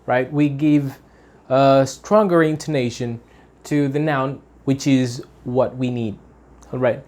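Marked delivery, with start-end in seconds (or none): none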